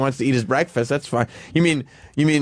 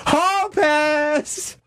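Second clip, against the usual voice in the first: much higher, a squealed hall pass; gleeful exclamation; 14 semitones higher than usual. Real, not acted. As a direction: shout it like a winning ticket. Gleeful cry after admitting crush.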